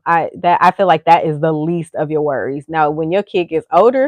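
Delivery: usual speaking voice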